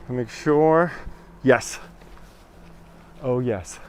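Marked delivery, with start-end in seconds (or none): none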